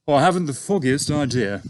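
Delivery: a hint of a breezy young officer; young pilot. A narrator's reading. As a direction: pilot answering his boss, lightly voiced breezy officer. Pilot voiced in read story.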